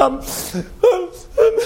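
high-pitched comic sobbing; hammed-up gulping cry; mock squalling. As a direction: imitate a child bawling, words catching. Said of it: "I'm squalling like that".

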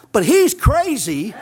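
ordinary delivery

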